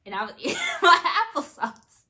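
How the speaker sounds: bursting into laughter mid-line; laughing. Real, not acted. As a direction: giggle it out, amused at herself. Laughing at herself eating applesauce.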